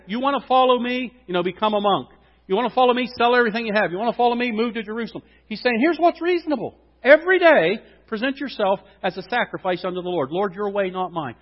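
high, loud fervent preaching; preaching at full force. Acted. Preacher urging the church, loud and fervent. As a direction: drive it home to the crowd.

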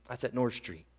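"that's at North Street" dropped low and soft; answering the setup, hushed and low. Acted. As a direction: let "North Street" fall away quietly. Sermon repetition, rising then falling.

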